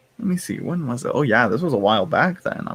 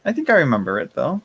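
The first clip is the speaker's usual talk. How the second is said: sudden recall; 10 semitones higher than usual. Retold or imitated. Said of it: "I was like".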